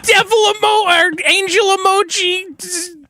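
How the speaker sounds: high, slow, whimpering read; mock whiny falsetto. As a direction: ham up the texts in a high wail. Mock reading of texts.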